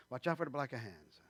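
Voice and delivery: quiet, lightly put-on Italian accent; understated Italian accent. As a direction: warn like an old Italian grandfather, in a faint accent. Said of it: "doing the Italian thing".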